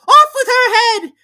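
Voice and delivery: screaming, very high and loud; shouting at the top of her voice. A narrator's reading. The Queen at Alice, screaming at the top of her voice. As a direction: scream the order with all your breath. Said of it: "the queen shouted at the top of her voice".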